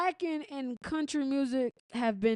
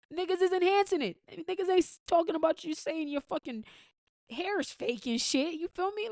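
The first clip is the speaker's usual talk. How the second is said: heated rant; 6 semitones higher than usual. Real, not acted. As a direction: pitch jumps higher, worked-up ranting at the haters. Heated rant at haters over hairlines.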